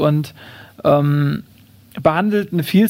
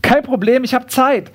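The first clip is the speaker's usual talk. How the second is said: higher, breezy reassurance; lightly put-on kangaroo voice, pitched up; easygoing kangaroo; 6 semitones higher than usual. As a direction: brush off the objection in a raised voice. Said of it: "sagt das Känguru".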